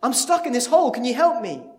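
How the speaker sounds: stuck man hollering up to his friend; shouting. Acted. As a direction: call out to Joe, pleading. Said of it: "and the guy shouts".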